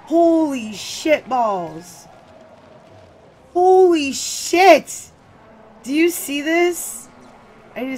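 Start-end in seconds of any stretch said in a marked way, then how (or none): 0.0-5.1 slow, drawn-out, much louder and higher cry; shocked exclamation. Real, not acted. Sees something alarming. 5.8-7.1 high, loud, slow alarmed question. Real, not acted. Alarmed at the sight.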